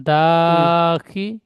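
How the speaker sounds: teacher drawing out দাখি- very slowly and clearly; slow model reading. Shown as a demonstration. Teacher modelling দাখি- slowly for a pupil. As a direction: stretch দাখি- out syllable by syllable for the pupil. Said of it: "পড়াটা reading বলেন।".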